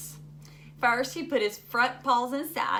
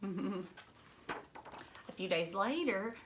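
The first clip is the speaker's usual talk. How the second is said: ordinary delivery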